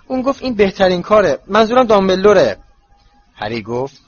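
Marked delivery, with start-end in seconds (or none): 0.1-2.6 higher, louder, urgent panting voice; breathless. A narrator's reading. Hermione explaining Dumbledore's decision to Harry, breathless. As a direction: say it as if short of breath.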